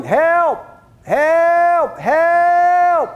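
man hanging from a cliff branch calls out, desperate yelling; yelling for help. Acted. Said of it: "holding on and yelling".

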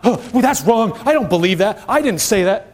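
a mild mock huff, offended and dismissive; touchy huffy voice. Preacher mimics a touchy believer, mild mock huff. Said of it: "God's not".

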